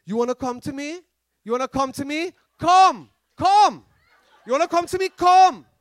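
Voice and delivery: Jamaican pastor's dare, measured then punched; Jamaican accent. Retold or imitated. Pastor daring the possessed man to approach, Jamaican accent. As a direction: build a Jamaican-accented challenge to a ringing "Come". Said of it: "with the Jamaican accent".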